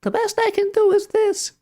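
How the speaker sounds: squeaky falsetto, very high; put-on falsetto, far above natural pitch. Shown as a demonstration. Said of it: "I can't even do a high-pitched voice".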